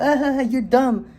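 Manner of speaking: high, mocking kid voice; taunting kid laugh. Fortnite kid mocking him as dumb, high and taunting. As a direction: jeer like a bratty child. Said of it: "and they're like".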